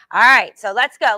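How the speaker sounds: loud let's-go call; coach rallying call. Real, not acted. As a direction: fire up the class. Coach rallying class to start.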